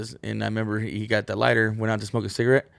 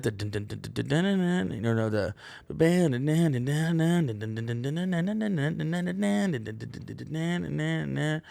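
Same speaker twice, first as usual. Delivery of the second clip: sung guitar riff on dun; riff imitation; 6 semitones higher, 3 dB quieter than usual. Imitating the Slayer riff for the viewers, vocal riff. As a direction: mimic the metal riff vocally. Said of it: "ripped into the Angel Death".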